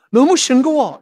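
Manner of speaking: high, loud mock grumble; complaining. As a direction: gripe like a never-satisfied eater. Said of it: "계속 불평만 해요".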